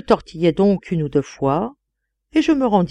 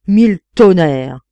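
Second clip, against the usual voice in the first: thundering curse; loud sworn oath; 8 dB louder than usual. A narrator's reading. Prince swearing at the sensation in the room, loud oath. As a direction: swear it out with full force. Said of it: "jura le prince de Grenouille".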